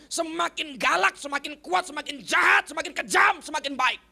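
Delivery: loud, high, punching each last word; drilled-in slogan. Retold or imitated. Preacher chanting his harsh upbringing, loud and emphatic. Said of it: "dididik dengan begini".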